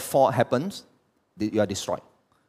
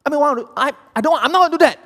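frustrated outburst; 13 semitones higher, 7 dB louder, much faster than usual. Retold or imitated. Frustrated self-talk retold.

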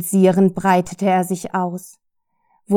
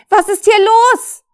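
much higher, loud yell over the chaos; panicked shout, pitched way up; bellowing; 13 semitones higher, 10 dB louder than usual. Acted. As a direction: roar to cut through all the voices. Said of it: "brüllte Karen".

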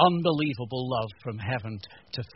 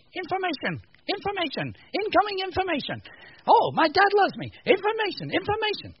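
robot voice; 4 dB louder than usual. Pretend. Robot brain voice mimicked.

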